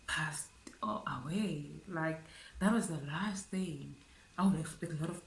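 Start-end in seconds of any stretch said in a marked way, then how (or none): 0.1-3.9 slow, hushed whisper. Real, not acted. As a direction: barely breathe the words out, slowly. Telling of friend's death.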